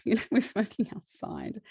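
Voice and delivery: real laughter breaking into speech; laughing. Real, not acted. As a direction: say it through a laugh at yourself. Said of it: "I'm laughing".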